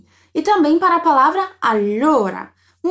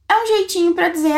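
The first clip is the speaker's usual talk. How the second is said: ordinary talk, nothing marked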